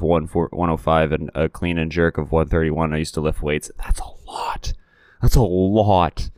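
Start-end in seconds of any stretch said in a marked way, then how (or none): 3.8-4.7 hushed, breathy aside in awe; whispered amazement; awed whisper. Real, not acted. Awed aside about the lift. 5.2-6.3 amazed. Real, not acted. Awe at lifted weight.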